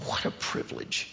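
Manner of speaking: breathy near-whisper of wonder; hushed awe. Real, not acted. Preacher to the men about his daughter, awed whisper. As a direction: let it fall to a whisper. Preacher marvels at child in lap.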